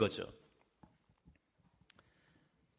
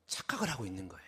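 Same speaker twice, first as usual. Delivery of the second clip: sudden hushed whisper at the end; breathy whispered punchline; 11 semitones lower, 15 dB quieter, much faster than usual. Real, not acted. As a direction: drop to a whisper to drive it home. Preacher drops to a whisper.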